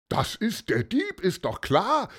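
high-pitched, cocksure accusation; accusing. A narrator's reading. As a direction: point the finger as a sure onlooker. Onlooker accuses boy.

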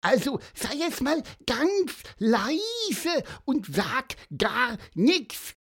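very high, drawn-out little bird voice; Pieper nagging. A narrator's reading. Pieper ordering his friend to be silent, high nagging. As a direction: insist in a high squeak, stretching the words.

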